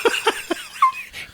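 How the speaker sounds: squeaky high-pitched giggle; strange high laugh. Real, not acted. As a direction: let out an odd squeaky laugh. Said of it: "Don't mind this".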